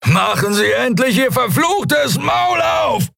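very high, drawn-out furious scream; strained, enraged yell; screamed demand. A narrator's reading. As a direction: scream at the silent captor, slow and high. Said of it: "schrie er".

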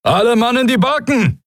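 high-pitched admiral's call to all hands; Rufen. A narrator's reading. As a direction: call out an urgent order, voice pushed way up. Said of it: "rief der Admiral". (rufender Befehl eines Admirals, hohe Stimme)

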